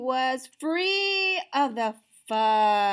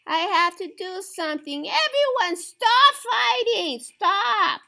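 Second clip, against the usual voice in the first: high, loud cry to stop the fight; desperate high shouted plea; pleading shout; 11 semitones higher, 7 dB louder than usual. Acted. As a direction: yell over the brawl to make them stop. Pip pleads, crowd fighting.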